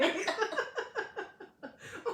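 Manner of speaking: loud high-pitched burst of laughter. Real, not acted. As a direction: let out a squealing laugh. Laughing at own example.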